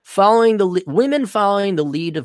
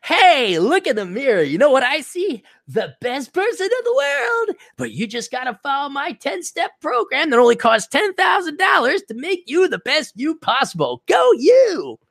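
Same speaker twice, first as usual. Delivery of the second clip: life coach; 10 semitones higher than usual. Retold or imitated. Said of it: "and I'm not like".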